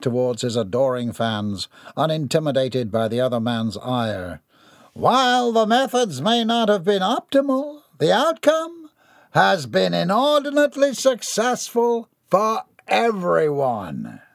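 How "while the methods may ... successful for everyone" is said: grand, puffed-up villain speech, higher voice; a self-important tycoon addressing the crowd; pompous villain. A narrator's reading. Gerard boasting to his adoring fans, pompous.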